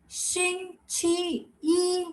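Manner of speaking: 星期一 very slow and high, three separate syllables; careful demonstration. Shown as a demonstration. Teacher models Monday in Mandarin, slow careful demonstration. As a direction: stretch out 星期一 one held syllable at a time. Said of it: "What is one in Mandarin?".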